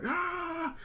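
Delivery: a very high yelp; short high squeal; cartoon yelp. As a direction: yelp like a burnt cartoon hero. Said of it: "He's like".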